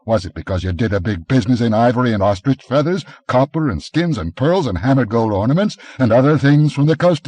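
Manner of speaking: hint of a warrior's mounting accusation; Conan, accusing. A narrator's reading. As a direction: hammer the merchant with his past.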